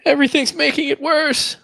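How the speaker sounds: high, comic despair; laughing mock wail. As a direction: squeal it with a laugh.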